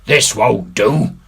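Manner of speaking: rally the crew with returning courage; Silver rallying. A narrator's reading. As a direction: bluff reassurance. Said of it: "His courage had come back".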